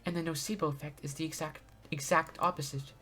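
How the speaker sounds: soft, polite princess reading; gentle princess voice. Acted. Voices Sonia character lines.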